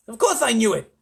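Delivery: loud, high, defensive retort; huffy President; blustering. A narrator's reading. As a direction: cover up with indignation.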